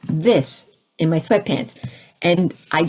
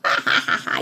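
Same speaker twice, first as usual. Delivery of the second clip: divine laughter imitated; 3 dB quieter than usual. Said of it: "the laughter".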